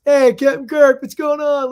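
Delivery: mock fan calling out to Kirk; fan greeting. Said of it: "Shatner gets".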